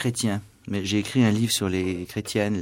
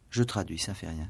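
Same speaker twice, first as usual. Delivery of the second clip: ordinary talk, nothing marked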